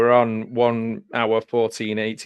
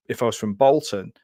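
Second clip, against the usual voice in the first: ordinary talk, nothing marked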